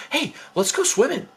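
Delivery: high-pitched, eager pitch to friends; excited suggestion. Retold or imitated. Brother urging a carful of friends to go, excited. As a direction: imitate an excited brother with a bright idea.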